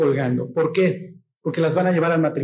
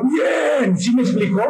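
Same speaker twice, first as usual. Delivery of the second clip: raised-pitch manly cheer, put on; macho yell; 5 semitones higher, 3 dB louder, slower than usual. Hairy-chested macho cheering on another man, mock yell. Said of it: "el macho con el pelo en pecho y le grita al otro".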